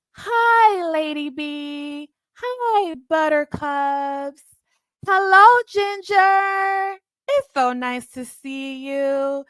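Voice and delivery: high, slow, sugary hello in a put-on voice; sweet high welcoming voice. Imitating the host greeting her chat sweetly. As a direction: coo each name sweetly and slowly. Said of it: "starts off so sweet".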